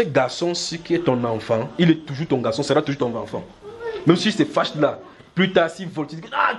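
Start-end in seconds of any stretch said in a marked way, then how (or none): none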